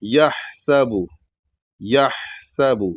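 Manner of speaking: یحسب with a throaty ha and whistled seen; throat ha. Shown as a demonstration. Teacher demonstrating letters from the throat. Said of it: "بڑا ہا کو حلق سے ادا کرنا".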